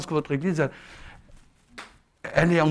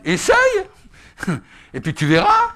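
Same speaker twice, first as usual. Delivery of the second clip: high, loud push to try; eager urging; 8 dB louder than usual. Retold or imitated. Preacher retelling how he urged the timid sister, high and eager. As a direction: coax her, bright and loud.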